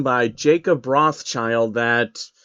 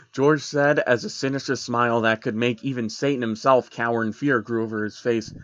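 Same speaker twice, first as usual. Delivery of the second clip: plain reading voice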